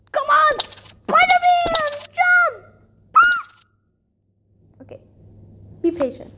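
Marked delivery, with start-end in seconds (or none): 0.1-2.6 high, loud urging; urgent shouting. Real, not acted. Player urges Spider-Man to jump, urgent shouting. 3.2-4.8 very high, loud squeal; frustrated shriek. Real, not acted. Player's jump fails, frustrated shriek.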